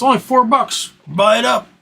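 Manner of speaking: high, hyped stock-pick shouting; Jim Cramer. Retold or imitated. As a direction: pitch stocks like a TV hype man. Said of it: "like Jim Cramer has".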